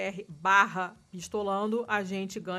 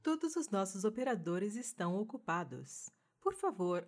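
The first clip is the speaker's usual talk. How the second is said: customer service voice; 5 dB quieter than usual. Pretend. Mock phone queue recording.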